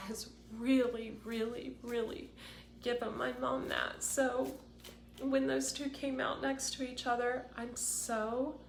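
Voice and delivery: tearful, high-pitched and halting; emotional. Real, not acted. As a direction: push through real tears to keep talking. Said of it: "this is making me so emotional".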